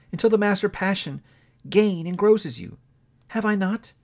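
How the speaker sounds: a hint of a hurt young woman letting go; Belle, lightly voiced. A narrator's reading. Young woman to her changed lover, lightly voiced and sorrowful.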